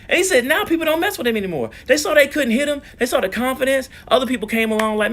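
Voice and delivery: high, fast and thrilled; excited. Real, not acted. Excited about the boy's triumph.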